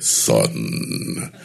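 very low, breathy-dark father's "Son"; deep Darth Vader voice. Retold or imitated. Father calls his son in a very low Vader voice. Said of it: "that Mustafa kind of voice".

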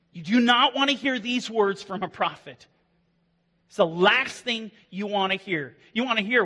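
ordinary talk, nothing marked

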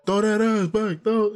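mock twerk video. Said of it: "it's like".

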